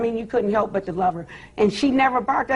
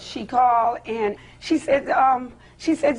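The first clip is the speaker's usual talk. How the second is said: ordinary talk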